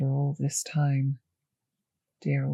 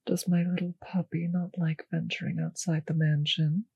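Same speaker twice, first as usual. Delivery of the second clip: usual speaking voice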